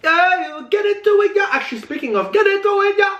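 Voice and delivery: high cooing yeah; squeaky high pigeon call. Real, not acted. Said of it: "sound like a pigeon doing a mating call".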